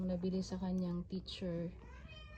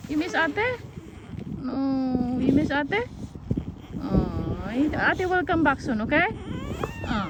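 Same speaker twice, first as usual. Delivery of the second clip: high, louder soothing sing-song; 8 semitones higher, 7 dB louder than usual. Real, not acted. Mom comforting James who misses his sister, high soothing baby talk.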